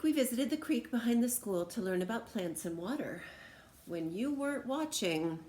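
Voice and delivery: ordinary delivery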